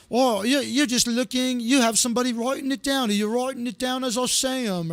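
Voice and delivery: high, put-on British voice; British accent. British friend suspecting a trick over Zoom, mock British accent. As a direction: imitate a suspicious British friend. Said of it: "He's British".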